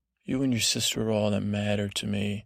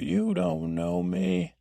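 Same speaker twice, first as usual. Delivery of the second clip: put-on high, slow, drawn-out teen protest; teenage outburst; 7 semitones higher, slower than usual. Teenage girl ending the argument, hammed-up wounded protest. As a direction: mimic a dramatic teenager, stretching every word. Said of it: "So as a teenage moment".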